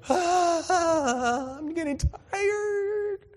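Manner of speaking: high, slow whiny complaint; tired disciples. Retold or imitated. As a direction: moan like worn-out rowers. Disciples rowing, mock complaint.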